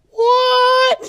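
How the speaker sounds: very high, loud mock-shocked squeal; shocked disbelief. Daughter learning her mom cusses at work, shocked squeal.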